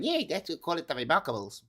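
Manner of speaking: put-on Kiwi accent in reply; New Zealand accent. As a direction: imitate a New Zealander answering. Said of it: "That's my New Zealand accent".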